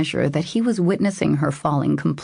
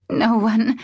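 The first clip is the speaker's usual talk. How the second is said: croaking; 7 semitones higher than usual. Acted. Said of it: "she croaked".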